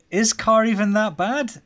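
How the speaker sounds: high, slow disbelieving question; incredulous. Real, not acted. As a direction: ask it in mock disbelief.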